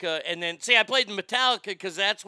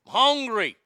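usual speaking voice

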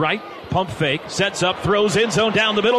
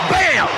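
shouted bam; 6 semitones higher than usual. Real, not acted. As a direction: bark out the hit. Hit sound called out.

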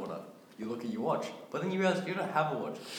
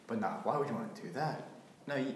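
usual speaking voice

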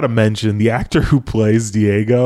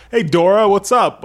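guy acting casual; 8 semitones higher than usual. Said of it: "he's like".

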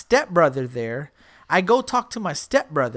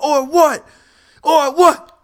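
high, loud repeated challenge; retold screaming; 7 semitones higher, 8 dB louder, much slower than usual. Refusing his friend's plea to leave, retold screaming. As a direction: yell it back while re-enacting the scene. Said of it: "And I'm, like, screaming".

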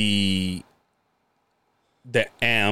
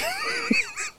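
burst of laughter; 6 dB quieter than usual. Real, not acted. Laughing at the stormtrooper line.

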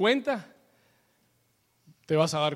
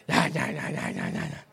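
cantaleta repetitiva; 4 semitones lower, 3 dB quieter than usual. Shown as a demonstration. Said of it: "Eso se dice en Colombia".

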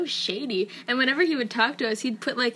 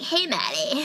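higher, girlier put-on voice; girly guy impression; 4 semitones higher than usual. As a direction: lift the greeting up girlishly. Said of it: "But more girly".

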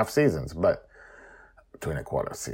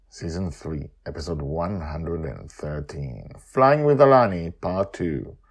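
story narration; 9 dB louder than usual. A narrator's reading.

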